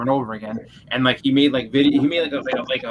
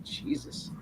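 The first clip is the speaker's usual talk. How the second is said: whispered disbelief; whispered aside; 13 dB quieter than usual. Retold or imitated. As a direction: breathe it out in shocked disbelief. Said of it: "I was like".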